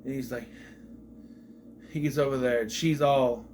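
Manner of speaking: ordinary talk, nothing marked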